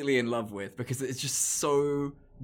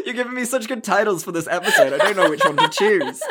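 laughing; 7 semitones higher, 8 dB louder than usual. Real, not acted. Laughing at co-host's joke.

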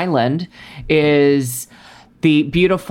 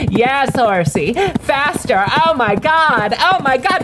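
higher and higher, squealing mock pleasure; mock ecstatic rider; slower than usual. Parodying the heroine on horseback, hammed-up ecstatic squeals. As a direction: ham up an ecstatic gallop.

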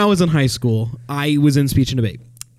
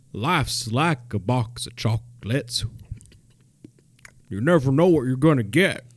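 Forrest Gump impression; 2 semitones lower, 5 dB quieter than usual. Forrest tells a stranger, slow Southern drawl. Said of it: "popped a piece of chocolate when he said it".

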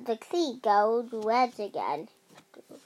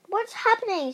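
high startled question; shouting; 9 semitones higher, 4 dB louder than usual. A narrator's reading. Rosie shouting as the magic changes her, high and raised. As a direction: call it out well above your usual pitch. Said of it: "shouted Rosie".

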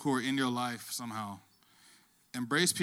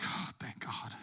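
whispered relief; 10 dB quieter, slower than usual. Retold or imitated. Relief when paramedics arrive.

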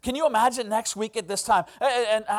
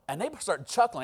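normal talking voice

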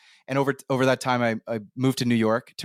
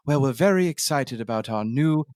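put-on British accent; 3 semitones higher than usual. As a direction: put on a stuffy British ministry spokesperson accent. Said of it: "like, the British Ministry of Immigration".

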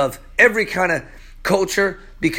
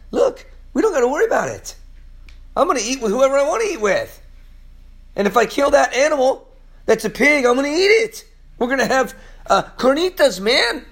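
higher, fast and carefree; eager Peter; 7 semitones higher, faster than usual. Acted. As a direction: rattle it off like a happy Peter. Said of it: "Peter's telling them".

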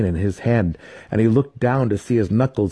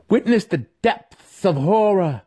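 high, slow, exultant cry with a laugh; fanatic cleric; 11 semitones higher than usual. A narrator's reading. Cleric crying to raiders at sunrise, exultant. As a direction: revel in their horror. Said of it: "the cleric cried".

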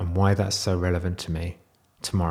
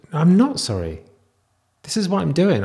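defiant; 9 semitones higher, 3 dB louder than usual. Real, not acted. Defiantly retracting his apology.

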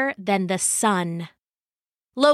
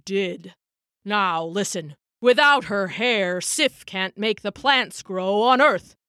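grave All-Father laying down the law; weighty, ominous godly warning; stern Odin. A narrator's reading. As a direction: speak with a ruler's solemn authority, slowing at the end. Odin voiced, sternly lecturing Loki.

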